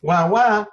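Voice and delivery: high, loud mock wailing; imitated baby wail. Kid wailing again at distracted parent, imitated wail. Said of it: "kid goes".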